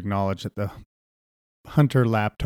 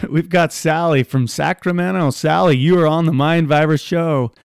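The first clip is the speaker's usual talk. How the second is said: louder, higher talk-radio host intro; mild mock host voice, louder; 5 semitones higher, 6 dB louder than usual. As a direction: welcome the caller to the show.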